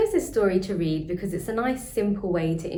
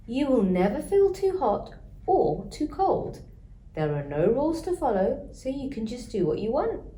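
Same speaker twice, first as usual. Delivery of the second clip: grandma voice; 6 semitones higher than usual. Acted. Grandma voiced, high and far.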